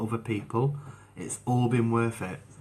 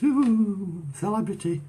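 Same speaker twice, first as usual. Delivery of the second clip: high, cooing mock fanfare; playfully sending up fame, much higher; mock starstruck; 9 semitones higher, 3 dB louder, slower than usual. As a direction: put on a high "ooh, fancy" voice. Mocks the idea of being famous.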